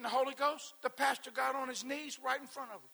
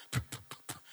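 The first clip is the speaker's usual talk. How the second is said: imitated zapping pew sounds; 3 dB quieter, faster than usual. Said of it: "I'd be like".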